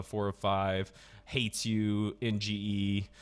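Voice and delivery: ordinary talk, nothing marked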